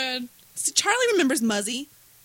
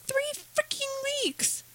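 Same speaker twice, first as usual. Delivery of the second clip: drawn-out, disbelieving complaint, much higher and slow; exasperated rant; 5 dB quieter, much slower than usual. Real, not acted. Venting to friends about the consulate's vacation, exasperated and slow. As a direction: stretch each word out in outrage at the delay.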